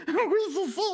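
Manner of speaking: high little puppet voice; frightened puppet. Acted. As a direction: answer as a timid, trembling puppet. Trembling puppet answers Philippe.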